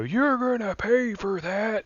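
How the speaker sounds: high, slow menacing cop threat; gruff cop voice. Acted. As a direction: drawl it out like a movie cop. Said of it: "Why does he sound like that?".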